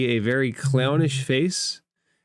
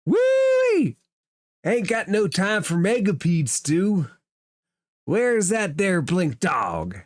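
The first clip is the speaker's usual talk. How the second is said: high whoop, then a raised folksy cook voice; yelling clownish cook; 8 semitones higher than usual. Acted. Cook searches the bar for the dog, high clownish yelling. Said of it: "And the cook yells".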